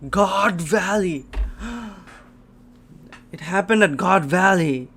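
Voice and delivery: loud, high astonished exclamation; thrilled shock. Real, not acted. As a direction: gasp and cry out the big reveal. Stunned by revealed location.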